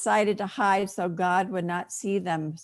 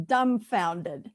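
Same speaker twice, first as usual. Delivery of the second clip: usual speaking voice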